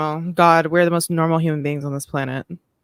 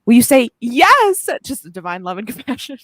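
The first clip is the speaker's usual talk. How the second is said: hammed-up, high, loud yes; over-the-top fervour; 6 semitones higher, 5 dB louder than usual. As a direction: belt out the yes with mock rapture. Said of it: "That was a lot".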